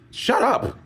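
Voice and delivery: very high-pitched, teasing dismissal; playful scolding. Real, not acted. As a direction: tease them with a squeaky telling-off. Mock scolding, then bursts out laughing.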